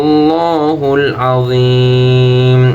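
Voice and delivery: slow melodic tajweed chant; Quran recitation. Acted. Teacher reciting so students read along, melodic chant. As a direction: chant the verse, stretching long vowels.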